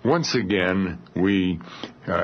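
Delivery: ordinary delivery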